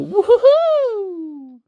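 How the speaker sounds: high, slow, laughing whoop; giddy drawn-out woohoo; excited woohoo. Real, not acted. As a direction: cheer it out with a laugh. Signing off for a break.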